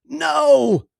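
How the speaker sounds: high loud cry of no; yell. Acted. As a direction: yell in panic. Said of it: "Brent yelled".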